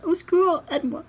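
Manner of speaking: high, hammed-up plea for rescue; mock cry for help. Vlogger mock-begging her boss for help, high hammed-up plea. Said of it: "j'ai envoyé un message à mon patron pour lui dire".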